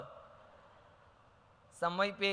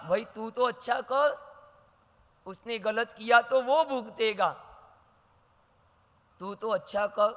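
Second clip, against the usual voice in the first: usual speaking voice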